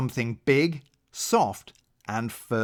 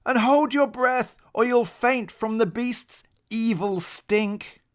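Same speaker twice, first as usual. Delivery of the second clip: urgent warning; 10 semitones higher than usual. Acted. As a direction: warn him with dread. Papa Rabbit's panicked warning continues.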